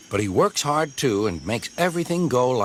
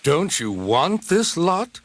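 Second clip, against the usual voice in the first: surprised engine voice; 6 semitones higher, slower than usual. Acted. Diesel asks.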